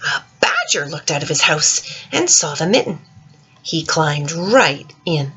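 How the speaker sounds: put-on gentle, lilting read-aloud for children; story reading. Teacher reading The Mitten to children, lilting storytime voice. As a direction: read it like storytime to little ones. Reads picture book aloud.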